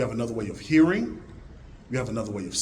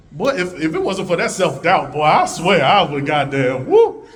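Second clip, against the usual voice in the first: loud mock rant; 3 semitones higher, 9 dB louder than usual. Speaker hamming up thanks for self-doubt, loud building whoop. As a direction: ham up the outrage, then whoop.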